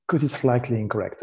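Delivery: usual speaking voice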